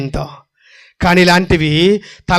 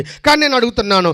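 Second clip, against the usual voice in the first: ordinary delivery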